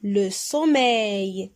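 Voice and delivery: Le sommeil said slowly and clearly; slow model word. Shown as a demonstration. Teacher models the word for the class, slowly. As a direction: stretch out Le sommeil for pupils. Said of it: "teacher models word".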